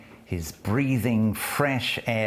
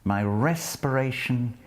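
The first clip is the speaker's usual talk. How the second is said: poetry recitation; 3 semitones higher than usual. Acted. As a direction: savour each line as you read it aloud. Lecturer reads the poem.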